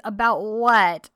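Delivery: loud evasive reply. Retold or imitated. Sanem dodging John's question, loud and slow. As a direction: stall him, playing dumb out loud. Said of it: "and she goes".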